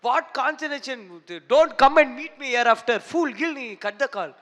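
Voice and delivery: retold angry call from the director, hammed up; angry director's scolding. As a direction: scold the assistant over the phone like a raging boss. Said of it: "He called me".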